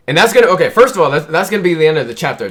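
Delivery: loud, high, agitated excitement; worked-up reaction. Real, not acted. Reader reacting to the chapter's end, loudly worked up. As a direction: burst out loudly over the cliffhanger.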